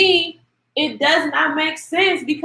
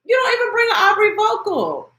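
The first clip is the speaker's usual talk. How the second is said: higher, near-shouted repeat of "You don't even bring an Aubrey vocal"; exasperated shout; 8 semitones higher than usual. Real, not acted. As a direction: shout it the second time, fed up. Repeating her point, louder the second time.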